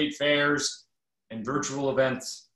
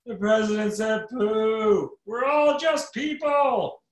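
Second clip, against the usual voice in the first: much higher, slow, drawn-out cry; a hammed-up final gasp; mock last words, high and slow; mock dying shout; 8 semitones higher, 3 dB louder, slower than usual. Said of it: "I would shout".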